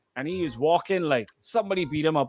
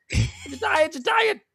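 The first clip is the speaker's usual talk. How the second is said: higher, pleading you tired; mock tired worker; 9 semitones higher, slower than usual. Imitating a worn-out worker at the job, higher whine. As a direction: whine it like an exhausted employee.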